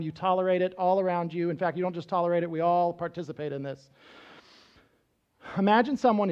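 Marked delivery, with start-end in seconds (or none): none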